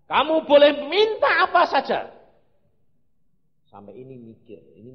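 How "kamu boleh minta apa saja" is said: very loud, high proclamation; booming divine offer. Retold or imitated. God offers the preacher any request, booming proclamation. God's grand offer, acted out.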